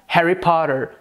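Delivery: "Harry Potter" with a flapped T and American R; American accent. Shown as a demonstration. Teacher comparing three accents, American accent. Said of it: "No inglês americano soaria como".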